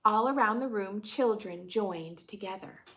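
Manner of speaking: put-on gentle read-aloud for children; a teacher reading a picture book aloud; storybook narration. A narrator's reading.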